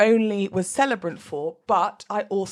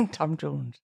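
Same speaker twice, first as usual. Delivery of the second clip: deep-set, softer Welsh accent; 6 semitones lower, 7 dB quieter than usual. Shown as a demonstration. Podcaster repeating her warm-up phrase, Welsh accent. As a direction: say it low and Welsh after a giggle. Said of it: "I'm doing a Welsh accent".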